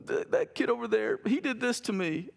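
put-on high, halting little-kid wail; imitated sobbing. Five-year-old son tattling to dad after a soccer game, mock sobbing. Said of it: "he's just sobbing uncontrollably".

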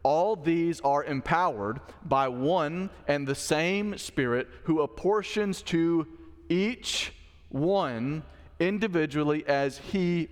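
slow it right down to make it land; slow emphatic reading. Shown as a demonstration. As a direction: read scripture slowly, word by word. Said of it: "this is very important".

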